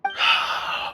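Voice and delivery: low, soft sigh of surrender; weary exhale before conceding; resigned sigh. Acted. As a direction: let out a sigh, giving in. Gives in to the questioning.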